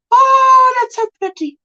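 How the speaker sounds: high, gushing "oh" of delight; gushing admiration. Retold or imitated. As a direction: gasp out admiration, starting high and loud. Quotes own admiring reaction.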